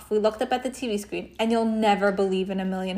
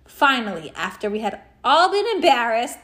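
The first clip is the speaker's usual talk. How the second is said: plain reading voice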